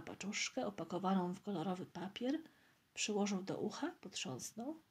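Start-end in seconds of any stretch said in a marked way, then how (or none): none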